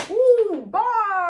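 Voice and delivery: high, loud "ooh, bar" cheer; hyped whoop. Real, not acted. Recapper cheering own wordplay, loud high whoop.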